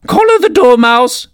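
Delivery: very high-pitched queenly shriek. A narrator's reading. Queen orders the dormouse seized, shrieking. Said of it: "the queen shrieked out".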